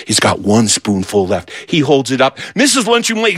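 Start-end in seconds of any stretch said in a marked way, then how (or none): none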